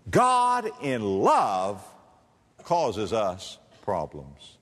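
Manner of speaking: slow, each word weighted; slow emphatic. Real, not acted. Preacher pressing a hard truth on his flock, slowly. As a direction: lay it down slowly. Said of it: "slow weighty point".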